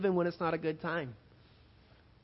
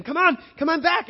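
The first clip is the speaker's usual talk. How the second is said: a much higher, louder frantic yell; mother yelling; 11 semitones higher, 11 dB louder than usual. Retold or imitated. Mother on the deck yelling to her son, a loud frantic yell. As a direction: scream at the boy to swim back. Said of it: "she's yelling at him".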